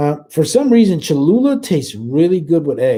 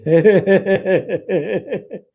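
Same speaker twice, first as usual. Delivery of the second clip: laughing; 5 semitones higher than usual. Real, not acted. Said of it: "laughs at chat comment".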